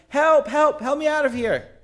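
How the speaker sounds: high-pitched cry for help; acted yelling. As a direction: call up out of a pit, pitched well up. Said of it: "he starts yelling".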